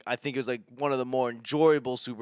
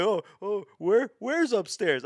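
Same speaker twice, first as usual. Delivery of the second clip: a higher, lightly put-on baffled voice, slowed; mock confused Brady; 7 semitones higher than usual. Comic mocking Brady asking where upstairs is, lightly voiced confusion. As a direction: play Brady lightly as a lost, puzzled guy.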